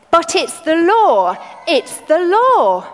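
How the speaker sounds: high, loud and slow insistence; angry protest. Acted. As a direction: hammer home that it's the law. Clerk argues, voices got louder.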